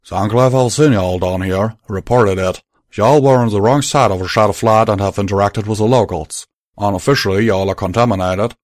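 loud, firm Southern cowboy, sorry underneath; Southern drawl. Acted. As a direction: break the news plainly, with regret. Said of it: "informed them sadly".

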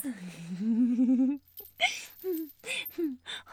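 long unhinged cackle; maniacal laugh. Acted. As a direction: cackle wildly, losing control. Villain gloating over captive.

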